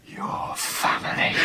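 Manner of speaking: slow, voiceless whisper; slow hushed whisper of dread; aghast whisper. Acted. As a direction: let the words fall out in horror. Victor stunned by family claim.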